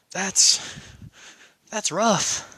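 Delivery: usual speaking voice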